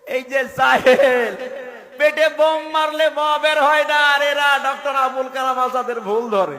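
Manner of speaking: full-force high-pitched tirade; very loud, high preaching outburst; scornful shout. Real, not acted. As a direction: thunder at the ignorant upstart. Berates the ignorant helper's presumption.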